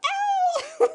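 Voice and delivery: high, loud put-on howl; hammed-up cry of distress; mock frantic wail. Women frantic for a coworker's help, mock wailing. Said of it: "we're frantic".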